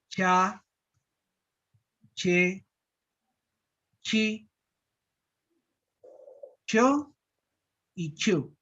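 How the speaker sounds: cha, che, chi, cho, chu with a hard, forceful ch; strong affricate syllables. Shown as a demonstration. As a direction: spit out each ch sharply. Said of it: "Se pronuncia tan fuerte esta letra". (sílabas con ch fuerte y marcada)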